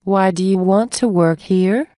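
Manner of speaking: usual speaking voice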